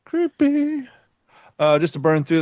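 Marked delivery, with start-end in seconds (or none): 0.0-0.9 spooky. Acted. Repeated spooky reaction.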